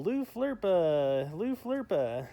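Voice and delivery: high, slow sky call. Shown as a demonstration. Parent calls out to the sky for the bird, high and drawn out.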